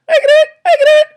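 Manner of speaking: very high, fast little squeak; piping eager squeak of a tiny bird; tiny hummingbird voice. Acted. Hummingbird eagerly offering help, tiny squeaky voice. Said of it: "the little voice".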